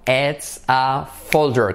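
slow, carefully spaced words; slow teacher model; slow model phrase. Shown as a demonstration. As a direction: say it slowly for the class to repeat. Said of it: "So, repite conmigo".

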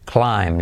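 "climb" dicho claim, sin b final; "climb": la i como ai y la b callada; b muda. Shown as a demonstration. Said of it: "Y la I es ae". ("climb" pronunciado claim, sin b)

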